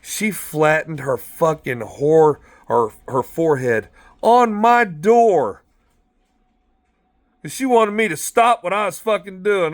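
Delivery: normal talking voice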